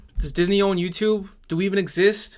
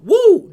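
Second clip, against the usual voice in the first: very high, loud mocking whoop; mock cheer; 13 semitones higher, 11 dB louder than usual. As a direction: cheer like a gloating troll. Mocking the streamer's celebration.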